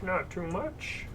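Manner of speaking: soft, lightly done Romano voice; Ray Romano voice. Acted. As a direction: murmur it with a Romano whine. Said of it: "I can't do that voice".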